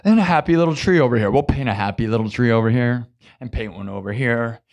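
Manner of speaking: low, soft painter murmur; Bob Ross. Retold or imitated. Streamer spoofing a TV painter, low and soft. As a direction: paint happy trees in a hushed low voice. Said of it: "I'm like Bob Ross".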